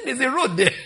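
high, loud laughing punchline; laughing quote. Retold or imitated. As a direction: crack up as you quote him. Retelling Cameroonian guest, laughing.

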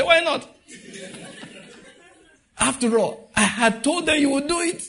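mock-outraged Jonah, laughing; defiant Jonah. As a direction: toss it back at God cheekily. Jonah answering God.

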